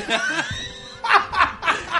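high shrieking burst of laughter. Real, not acted. Big laugh.

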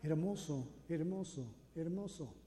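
soft, fading echo of "Hermoso"; quiet echo fading out. Retold or imitated. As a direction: let it die away down the cave. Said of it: "Y el eco".

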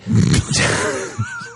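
high breathy villain cackle; sinister giggle; evil laugh. Retold or imitated. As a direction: cackle like a creepy psycho.

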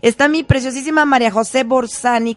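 loud, beaming radio shout-out; excited greeting. Real, not acted. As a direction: call out her name with delight. Radio host greets distant listener.

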